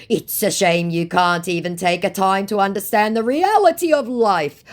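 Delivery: high, loud, building lament; mock reproach. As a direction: imitate a wounded scammer, pitch climbing. Acts out scammer's reproachful reply.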